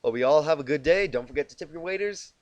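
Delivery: plain reading voice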